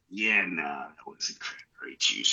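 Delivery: low, soft imitated doctor's verdict; grave doctor. Doctor correcting patient about cranberry juice, hushed and grave. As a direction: play the doctor, dropping quietly and slowly. Doctor breaks news to him.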